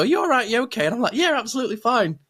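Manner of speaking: high, chirpy check-in; bright concerned voice. Retold or imitated. Retold exchange at social event.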